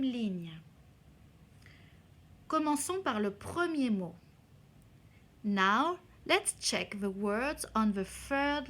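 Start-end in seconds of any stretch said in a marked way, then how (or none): none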